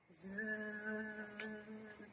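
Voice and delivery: soft breathy snore, zzz; snoring sound. Shown as a demonstration. Teacher snoring for the sleeping bear. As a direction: make a sleepy snoring buzz.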